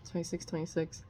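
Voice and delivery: under-the-breath count, fully whispered; murmured aside to self, no voice. Real, not acted. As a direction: whisper it to yourself.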